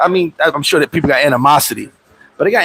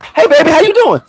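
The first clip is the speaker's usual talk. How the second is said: high sweet-talking voice; mock sweet; 10 semitones higher than usual. After the gym, sweet-talking the friend he provoked, high and mock sweet. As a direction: sweeten it up all of a sudden. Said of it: "I'll be like".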